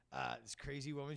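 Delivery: ordinary talk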